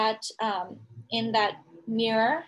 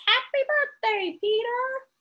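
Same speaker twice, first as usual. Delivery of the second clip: high squawky parrot, much higher; 12 semitones higher, slower than usual. Acted. The parrot greets Peter, high squawky parrot. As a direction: squawk it like a talking bird. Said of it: "the parrot says".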